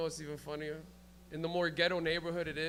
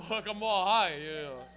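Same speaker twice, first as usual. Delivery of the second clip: ordinary talk, nothing marked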